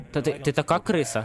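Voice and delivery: normal talking voice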